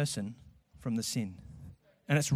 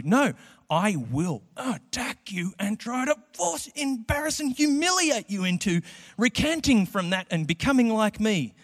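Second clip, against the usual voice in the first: high, pushy mock attacker; hammed-up bully at university; 7 semitones higher than usual. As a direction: lay into them with mock aggression. Voicing aggressive university critic.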